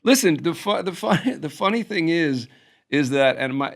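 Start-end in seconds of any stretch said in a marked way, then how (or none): none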